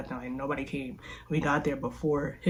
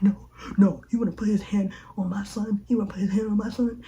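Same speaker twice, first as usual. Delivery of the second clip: angry dad; 5 semitones higher than usual. Retold or imitated. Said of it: "he's like".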